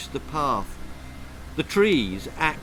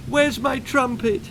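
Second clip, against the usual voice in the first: high, small anxious voice; a scared kid asking; frightened boy; 10 semitones higher, 3 dB louder than usual. A narrator's reading. Bullied boy asking for his trumpet, high and anxious.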